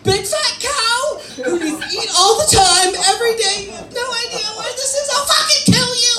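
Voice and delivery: high, hammed-up tearful wail breaking into a yell; mock crying. Insecure wife asked if she ate today, crying. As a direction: wail it like a wife in tears, then erupt. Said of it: "She starts crying".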